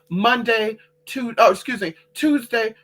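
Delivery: normal talking voice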